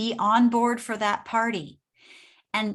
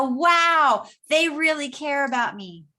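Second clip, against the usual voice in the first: higher and louder, pleased and impressed; delighted customer; 5 semitones higher, 5 dB louder, slower than usual. Retold or imitated. Customer reacting to the caring shop, amazed and louder. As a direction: gush like a grateful customer. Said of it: "And they're gonna go".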